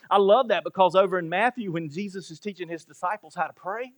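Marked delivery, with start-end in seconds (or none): none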